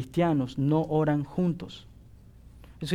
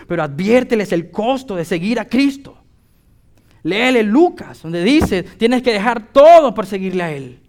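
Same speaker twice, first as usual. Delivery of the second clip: loud preaching, pitched higher, driving every phrase; predicación fervorosa; 8 semitones higher, 11 dB louder than usual. Acted. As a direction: preach it hard to the congregation. Preaching climax. (predicación a todo pulmón, más aguda y fuerte)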